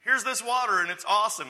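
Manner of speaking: loud, high, hyped-up offer; hammed-up enthusiastic announcement; excited pitch. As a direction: sell it like an amazing find. Voices the offer at the well.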